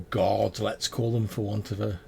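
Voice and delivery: ordinary talk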